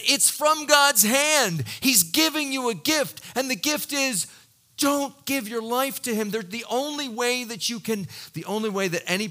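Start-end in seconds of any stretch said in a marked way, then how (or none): 0.0-8.1 preaching loud. Real, not acted. Preacher at full force.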